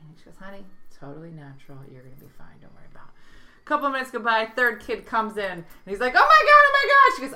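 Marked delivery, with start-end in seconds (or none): 0.4-3.6 soothing mom. Retold or imitated. 6.1-7.1 very high, loud frantic wail; panicked kid. Retold or imitated.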